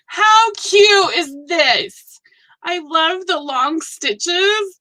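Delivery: slow, high and loud delight; gushing. Real, not acted. Admiring a viewer's long stitches, slow high gushing. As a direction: draw out every word in adoration.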